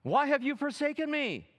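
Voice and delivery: loud, high anguished cry. Acted. Jesus on the cross crying to God. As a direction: cry out in abandonment. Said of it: "he cries out".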